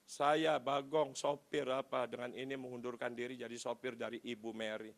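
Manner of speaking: low, subdued, monotone dictation; dictating a letter. Retold or imitated. Boss dictating resignation to driver, subdued recital. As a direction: recite it piece by piece, softly fading.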